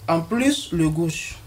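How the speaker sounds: normal talking voice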